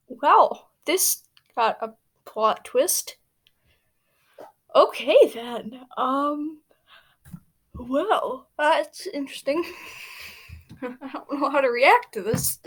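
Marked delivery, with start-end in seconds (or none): none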